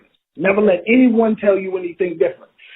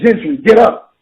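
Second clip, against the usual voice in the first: sharp loud command from the drill sergeant; barked order; 3 semitones higher, 6 dB louder than usual. Retold or imitated. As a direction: drill sergeant barking at a fallen recruit, loud. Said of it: "he said".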